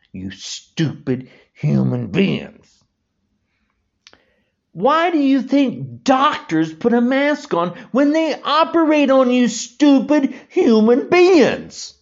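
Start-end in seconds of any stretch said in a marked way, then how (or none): 4.7-12.0 raised, pitched-up angry yell; angry shout. Real, not acted. Ranting at anti-maskers about doctors' masks, angry raised shout. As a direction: yell it at them, fed up.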